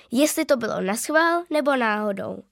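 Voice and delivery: usual speaking voice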